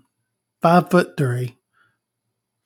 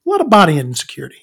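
fast repeat; 2 semitones higher, much faster than usual. Shown as a demonstration. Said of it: "I have a lot of body".

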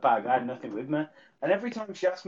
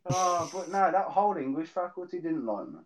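normal talking voice

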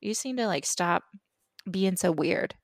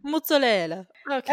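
a hammed-up Italian "mozzarella"; mock Italian accent; 5 semitones higher than usual. Teasing the TV chef, Italian "mozzarella". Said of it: "say it like that".

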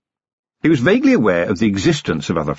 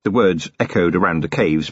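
plain reading voice

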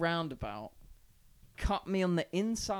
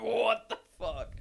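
laughing disbelief; 7 semitones higher, 5 dB louder, slower than usual. Retold or imitated. Biker yanks open his car door.

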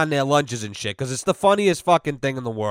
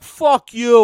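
higher, louder retold curse; angry retort; 7 semitones higher, 7 dB louder than usual. Heckled workers cursing back at him, angry retort. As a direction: throw it back as a loud, mock-angry comeback.

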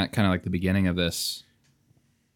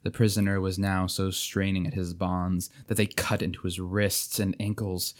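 usual speaking voice

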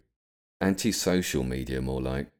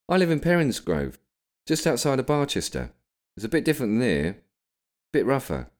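lightly acted, higher reply; character in conversation; 8 semitones higher than usual. A narrator's reading. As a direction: answer a stranger's question in a higher voice. William answers barmaid's question.

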